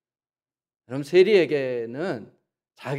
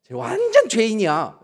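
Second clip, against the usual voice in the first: mock accusation; 7 semitones higher, 4 dB louder, slower than usual. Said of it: "이러는데 난 깜짝 놀랐어요".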